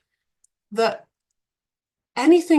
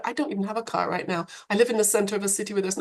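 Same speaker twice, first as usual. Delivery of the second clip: usual speaking voice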